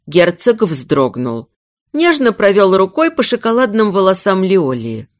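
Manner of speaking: ordinary delivery, nothing marked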